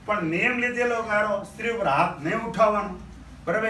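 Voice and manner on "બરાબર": ordinary talk, nothing marked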